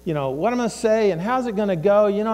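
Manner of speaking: ordinary talk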